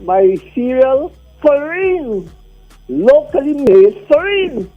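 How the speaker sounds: high, slow proud emphasis; proud announcement. Real, not acted. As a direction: show it off proudly. Caller proudly names local cereal.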